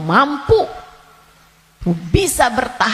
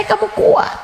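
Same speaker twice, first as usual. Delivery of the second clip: normal talking voice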